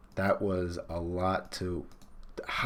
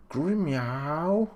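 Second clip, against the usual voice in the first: cat roar; 7 semitones higher than usual. Acted. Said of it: "That is the roar of".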